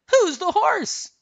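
very high, loud, drawn-out question; high, loud, eager snowman; Olaf. A narrator's reading. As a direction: ask slowly in a high cartoon voice. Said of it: "Olaf said".

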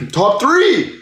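excited shout that cracks high. Real, not acted. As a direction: voice breaks on the excited yell. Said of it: "Voice crack".

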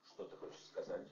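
tense hushed question. Acted. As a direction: ask it under your breath. Wary question, fearing answer.

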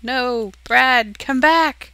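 loud, high panicky cry to Brad; hammed-up panic as the dog attacks; mock panicked player. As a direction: yell after Brad in mock alarm. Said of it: "and you're like".

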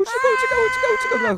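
high, loud frantic repeats; panicked shout. Pretend. Wolf fleeing the hunter, panicked shout. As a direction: yell the escape in play panic.

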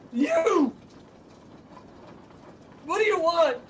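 high-pitched cry into the dark; acted, startled demand; alarmed challenge. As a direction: confront an unseen intruder, pitched very high. Bennett confronts the presence in dark.

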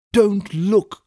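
high-pitched, urgent warning; desperate cry. A narrator's reading. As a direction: cry out desperately to stop him. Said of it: "absolutely desperate".

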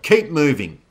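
loud, high command; urgent commander's shout; yelled order. A narrator's reading. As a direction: yell to drive riders on. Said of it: "Zoe yelled".

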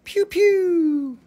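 high squeaky blaster noise; mock laser pew. Seller playing with a stone, squeaky pew pew. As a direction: zap it like a toy ray gun.

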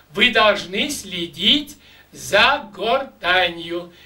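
usual speaking voice

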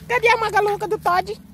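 high doting pet voice. Real, not acted. Cooing to the dogs.

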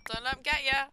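hype-up yell; loud. Real, not acted. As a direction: shout the warning at yourself. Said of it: "Don't let 'em get ya".

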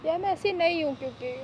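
crying. Real, not acted. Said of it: "रो क्यों रही है?".

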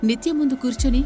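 ordinary delivery

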